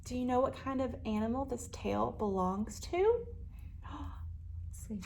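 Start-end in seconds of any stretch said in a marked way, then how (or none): 3.8-5.0 gasped whisper; hushed gasp. Acted. Storyteller whispers to the children. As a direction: breathe it with suspense.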